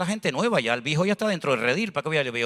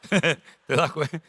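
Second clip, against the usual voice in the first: slow, laughing question; slow amused ask with a laugh; 3 semitones lower, much slower than usual. Real, not acted. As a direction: chuckle through the words. Laughing aside after proverb.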